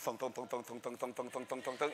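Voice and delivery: low, soft, steady rhythmic thunks; quiet mechanical chug; imitated clacking coaster. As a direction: repeat it evenly like a ratcheting chain lift. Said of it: "you hear the thunk".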